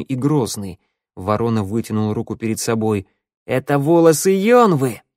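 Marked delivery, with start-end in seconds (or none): 3.5-5.0 raised, louder revelation; accusing announcement. A narrator's reading. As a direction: proclaim the damning find. Ворона triumphantly exposes his find. (обличающе, громко)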